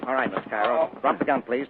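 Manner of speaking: normal talking voice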